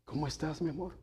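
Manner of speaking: soft, loving question to a spouse; tender greeting. Shown as a demonstration. Pastor showing a wife's loving greeting, soft and tender. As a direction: ask it gently, full of affection. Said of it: "Es la misma palabra, pero el tono cambia".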